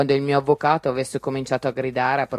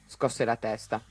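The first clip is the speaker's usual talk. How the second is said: ordinary delivery, nothing marked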